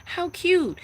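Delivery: very high cooing mock voice with a laugh; high mocking coo, a doting mother brushing it off; mock doting mom. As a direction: parody the parent who finds it cute. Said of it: "You think I go".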